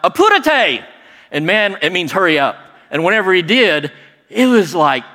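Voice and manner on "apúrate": high, loud barked command in Spanish; yelled order. Retold or imitated. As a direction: call the crew to hurry. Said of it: "he yelled out".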